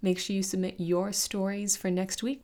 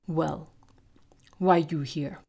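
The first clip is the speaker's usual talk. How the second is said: broken-language orc; 2 semitones lower, slower than usual. Acted. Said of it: "spoke in broken Tarnese".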